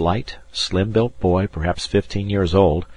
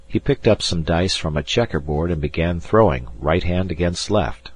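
usual speaking voice

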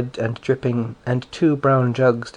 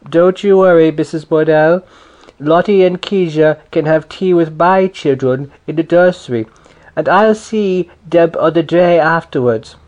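loud, higher hearty neighbour voice; jolly big neighbour; 4 semitones higher, 6 dB louder than usual. A narrator's reading. Big neighbour reassuring the grandmother, loud and hearty. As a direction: boom it out like a big jolly mother. Mrs Josephs speaks to grandmother.

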